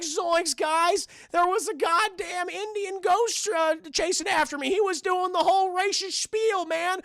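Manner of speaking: high-pitched flustered cartoon slacker; Shaggy. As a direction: report the scare in a goofy sidekick voice. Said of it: "and Shaggy's like".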